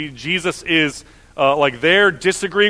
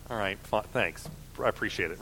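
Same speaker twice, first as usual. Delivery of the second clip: much softer, lower grudging aside; muted thanks; 7 semitones lower, 15 dB quieter than usual. Acted. Brushing off a nitpicker who corrects you, muted. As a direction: drop to a low mumble to brush them off.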